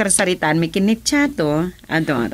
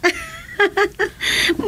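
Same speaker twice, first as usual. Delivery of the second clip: bright peal of laughter, much higher; high delighted laugh; 3 dB quieter than usual. Acted. Woman laughing at Chato's joke, high delighted laugh.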